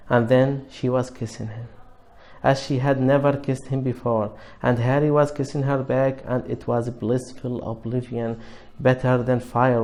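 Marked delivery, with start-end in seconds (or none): none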